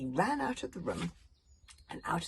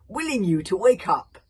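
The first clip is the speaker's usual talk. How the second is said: loud urging; 4 semitones higher, 14 dB louder than usual. A narrator's reading. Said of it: "said Valhalla Rama".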